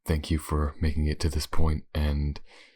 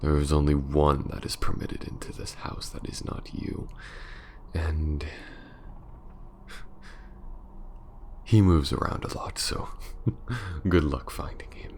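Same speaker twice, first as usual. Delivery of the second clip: menacing vampire lady. Acted. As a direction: purr each threat like a cruel castle mistress savouring the hunt. Villainess threatens her prey, whispering.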